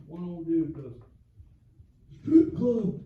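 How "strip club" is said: louder, a laugh in the voice; blurted old man. Retold or imitated.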